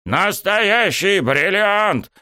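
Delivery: high, drawn-out rejoicing; elated yell. A narrator's reading. Coach thrilled with his discovery, elated yell. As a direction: cry it slowly, savouring the find. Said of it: "завопил он вдруг опять".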